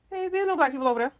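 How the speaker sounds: high, fast mock surprise; quick, high-pitched joking exclamation; joking remark. Shown as a demonstration. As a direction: rattle it off high as a quip. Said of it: "saying in a joking manner".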